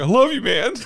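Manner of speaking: very high mock-weepy declaration; hammed-up tearful affection; mock crying. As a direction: blubber it like an emotional drunk. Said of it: "and crying".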